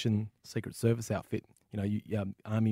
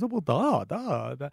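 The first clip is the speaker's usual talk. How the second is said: put-on comic Russian accent, raised pitch easing down; General Yuri; 6 semitones higher, 4 dB louder, slower than usual. General agreeing to tell another story, mock Russian accent. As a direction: think it over as a Russian general. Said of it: "I'm terrible with accents".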